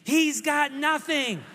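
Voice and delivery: lightly voiced yelling coaches; mock taunting yell. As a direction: jeer at the kid, drawn out.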